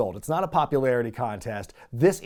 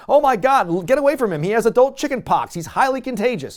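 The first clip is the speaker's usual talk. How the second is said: high, loud hammed-up alarm; cartoonish higher, louder panic; mock panic; 9 semitones higher, 6 dB louder, faster than usual. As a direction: warn everyone away in a fake fright. Voicing panic over chicken pox.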